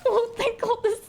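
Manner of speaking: panicked high cries easing to relief; scared then relieved. Real, not acted. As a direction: yelp in fright, then sigh with relief. Enemy teams everywhere, then relief.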